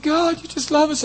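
high, awed cry of praise to God; a hushed gasp of gratitude breaking into praise; overwhelmed praise. Retold or imitated. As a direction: let the wonder lift the voice up high. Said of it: "And I'm like".

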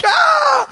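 very high, loud imitated scream; mock scream. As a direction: shriek in comic exasperation. Acts out friend's exasperated scream.

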